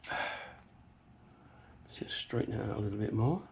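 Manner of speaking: soft whisper to himself. Real, not acted. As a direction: breathe it out under your breath. Whispered aside while working.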